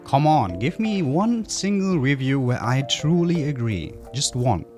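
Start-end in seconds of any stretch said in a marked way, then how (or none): none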